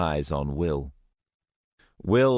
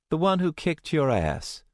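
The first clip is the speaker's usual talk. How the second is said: higher, taunting female voice; cocky young woman; 10 semitones higher than usual. Acted. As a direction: throw it back with a smirk. Luna retorts.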